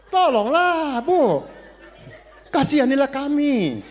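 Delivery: high, loud, laughing plea for mercy; mock pleading. Pastor jokingly begs his wife for mercy, mock pleading.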